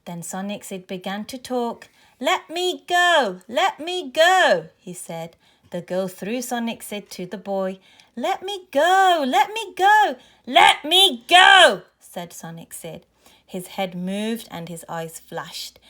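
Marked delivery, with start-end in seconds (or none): 2.2-4.7 high, loud, drawn-out plea; urgent demand. A narrator's reading. Captured toy robot to the children throwing it, urgent demand. 8.1-11.8 high, loud cries building to a yell; urgent demand. A narrator's reading. Toy robot being thrown between bullies, escalating urgent demand.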